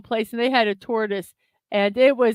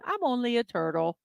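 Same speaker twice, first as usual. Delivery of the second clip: ordinary talk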